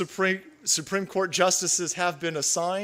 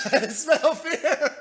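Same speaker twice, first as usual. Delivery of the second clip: laughing taunt; 8 semitones higher, much slower than usual. Real, not acted. Laughing repeat.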